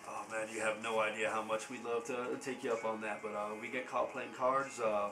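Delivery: lightly voiced weary ranch hand.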